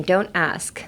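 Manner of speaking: don't ask with no T, toʊ̃nt æsk blended; silent T. Shown as a demonstration. Teacher shows the silent T in don't ask. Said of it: "The T is silent".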